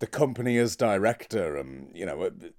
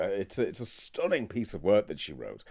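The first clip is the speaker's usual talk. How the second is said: usual speaking voice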